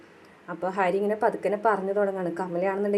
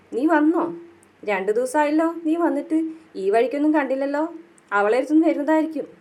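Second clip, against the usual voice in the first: mock cheery greeting; 6 semitones higher, 3 dB louder than usual. Mother greets son coming home.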